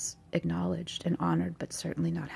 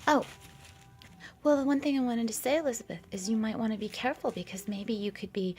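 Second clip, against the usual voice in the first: fairy voice; 5 semitones higher, 5 dB louder than usual. Pretend. Fairy warns Elizabeth.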